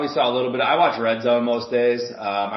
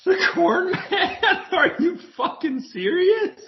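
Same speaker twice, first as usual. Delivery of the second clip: much higher, slow, laughing disbelief; incredulous laugh at the idea; slower than usual. Real, not acted. Host hearing about the corn man, laughing in disbelief.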